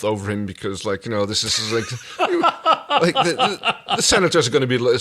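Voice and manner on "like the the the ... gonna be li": high laughing words tumbling out fast; cracking up mid-thought; laughing fit. Real, not acted. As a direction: laugh through the sentence.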